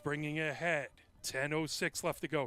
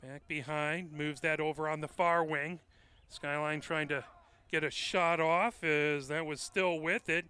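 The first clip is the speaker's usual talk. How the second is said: ordinary talk, nothing marked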